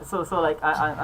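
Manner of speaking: ordinary talk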